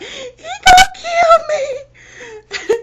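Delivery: shrill, slow terrified wail, half laughing; terrified cry. Retold or imitated. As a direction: replay the panic with a laughing cry. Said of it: "and I was just like".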